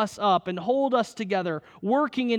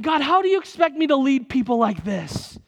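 Moses freaking out; 5 semitones higher, 4 dB louder than usual. Retold or imitated. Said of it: "Moses is freaking out".

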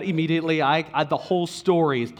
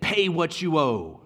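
threatening demand. Acted. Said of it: "seizing him by the throat".

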